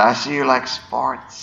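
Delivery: slow, lightly voiced eerie villain; a hint of a creepy telepath, drawn out; Psycho Mantis voice. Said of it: "where you're fighting Psycho Mantis".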